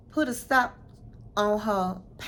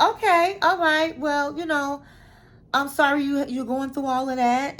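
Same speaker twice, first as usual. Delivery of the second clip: lightly voiced officials, raised and loud; 6 semitones higher, 5 dB louder than usual. Voicing officials who sympathize with her story, a touch raised and loud. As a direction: mimic the officials' sympathetic reply, pitched up.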